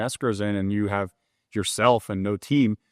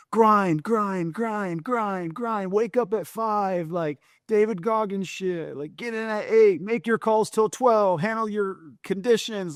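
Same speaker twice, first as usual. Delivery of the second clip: much higher, put-on hype-bro voice chanting the routine; mock hustle guru; 10 semitones higher, slower than usual. Mocking the loan industry's grind-culture mantra to the host, in a high put-on hustle-bro voice. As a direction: parody a grind-culture guru barking the schedule, slowing on the hours.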